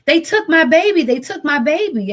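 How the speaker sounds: imitating sister's high, loud desperate cry; retold screaming. Sister calling about her killed child, retold high scream. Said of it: "calls me and she's screaming".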